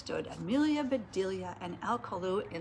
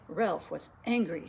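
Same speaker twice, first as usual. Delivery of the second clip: usual speaking voice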